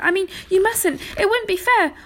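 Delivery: high, loud panicked protest; panting. A narrator's reading. Arthur begging not to be left behind, panting. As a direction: plead urgently as if out of breath. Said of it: "panted Arthur".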